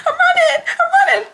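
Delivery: very high, loud mock squeal; imitated panicked sister. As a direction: squeal it back like a flustered little sister. Voices sister answering during chase.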